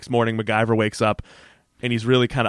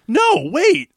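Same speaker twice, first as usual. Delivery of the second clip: high, indignant imitation; hammed-up sarcastic disbelief; sarcastic outrage; 10 semitones higher, 4 dB louder than usual. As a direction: act out incredulous accusation, pitched up. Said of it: "it was sarcastic Mac".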